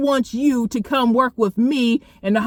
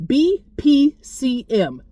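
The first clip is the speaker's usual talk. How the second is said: spelled out slowly; 3 semitones higher, much slower than usual. Shown as a demonstration. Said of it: "B as in boy".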